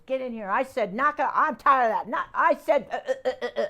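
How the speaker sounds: mock sergeant snapping at kids; drill sergeant. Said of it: "a drill sergeant snapping orders, yelling at the kids".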